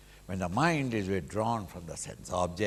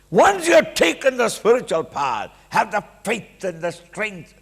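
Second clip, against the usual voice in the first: forceful preaching; 10 semitones higher, 9 dB louder than usual. Real, not acted. Preacher exhorting at full force.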